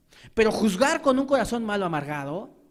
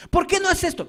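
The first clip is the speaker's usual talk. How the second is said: much higher accusing question; mocking reproach; 10 semitones higher, 4 dB louder than usual. Preacher mimicking a nagging spouse, high. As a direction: demand it like a nag.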